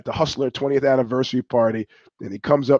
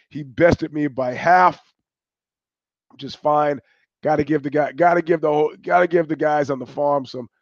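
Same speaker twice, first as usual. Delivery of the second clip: normal talking voice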